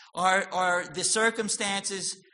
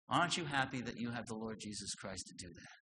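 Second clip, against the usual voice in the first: ordinary talk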